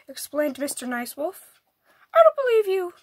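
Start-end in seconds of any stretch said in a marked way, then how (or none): 2.1-2.9 suspicious squirrel. A narrator's reading. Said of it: "said the squirrel".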